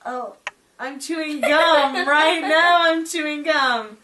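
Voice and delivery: put-on high, slow, laughing child voice; laughing imitation. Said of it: "you're like".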